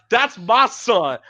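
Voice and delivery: high, slow, proud declaration; proud mama. Retold or imitated. Proud mama showing off her son's painting, high and slow. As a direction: boast like a beaming mother. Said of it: "and she's like".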